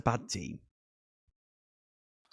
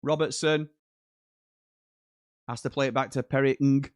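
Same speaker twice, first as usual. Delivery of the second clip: normal talking voice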